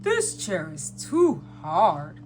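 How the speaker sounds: slow, low storybook Santa; Santa Claus. A narrator's reading. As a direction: complain slowly in a low Santa voice.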